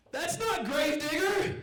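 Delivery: very high, drawn-out wail; crying kid. Retold or imitated. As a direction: imitate a child's tearful tantrum. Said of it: "he starts crying".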